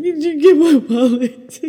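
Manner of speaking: high, loud desperate blurt; flustered new mom pleading for help at the till; frantic plea. Retold or imitated. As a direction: beg for help, overwhelmed. Frazzled mom at the register.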